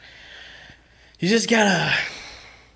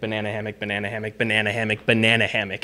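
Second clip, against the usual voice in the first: fast tongue twister; 4 semitones lower, 5 dB quieter, faster than usual. Shown as a demonstration. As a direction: rattle it off fast and softer. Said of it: "banana hammock five times as fast as you can".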